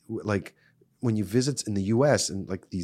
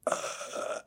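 soft voiceless rasping groan; imitated possessed groan; 11 dB quieter than usual. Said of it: "I see the person's like".

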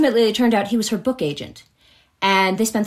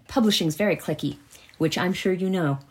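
ordinary delivery

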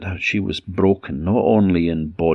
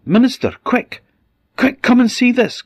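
urgent call, much higher and louder; shouted call; 6 dB louder than usual. Acted. Men call the minister upstairs, shouting urgently. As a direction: yell up for him to come quick. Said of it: "the men shouted to her husband".

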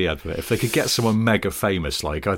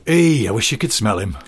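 mock Lancashire accent, slightly raised; Hilary Devey; 3 semitones higher, 4 dB louder than usual. Devey shows off her lookalike, hammed-up northern English accent. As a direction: ham up a northern advert line. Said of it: "she'd go up to him and just go".

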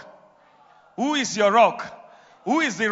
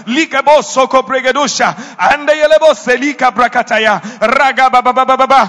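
loud, rapid tongues; speaking in tongues; 4 semitones higher, 10 dB louder than usual. Real, not acted. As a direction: pour out tongues in fervent prayer.

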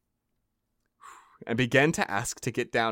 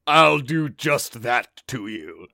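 slow menacing giant; 5 dB louder, slower than usual. Acted.

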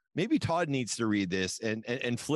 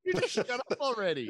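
mock fed-up woman; 9 semitones higher than usual. Voicing the woman.

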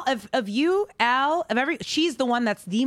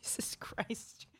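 exasperated whisper; 16 dB quieter, slower than usual. Real, not acted. Exasperated at co-host's Samsung joke.

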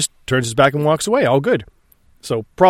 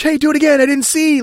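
much higher, excited little voice; eager onlookers; 13 semitones higher than usual. Retold or imitated. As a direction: beg like an eager kid at the wall. Onlookers begging over the wall.

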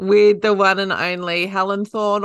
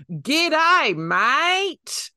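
put-on ramped-up Australian accent; 9 semitones higher than usual. Host imitating the Australian accent in Mission Impossible, ramped-up Aussie. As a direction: ham up the Australian, drawn right out. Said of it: "really ramp up the Australian, like, accent".

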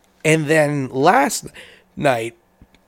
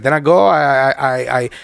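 imitated Puerto Rican accent, repetitive and halting; stammering impression in a Puerto Rican accent; Harry; 3 semitones lower than usual. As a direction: mimic a Puerto Rican man's accented English. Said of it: "he's like".

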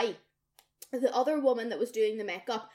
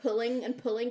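ordinary delivery

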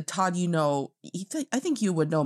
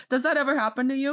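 ordinary delivery

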